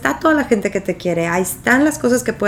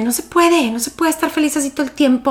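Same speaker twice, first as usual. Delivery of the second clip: higher, fast irritated complaining voice; high-pitched exasperated grumble; annoyed friend; 7 semitones higher than usual. Retold or imitated. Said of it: "esta amiga como que se enojaba y me decía".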